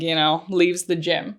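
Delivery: ordinary talk, nothing marked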